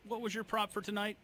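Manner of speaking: ordinary talk, nothing marked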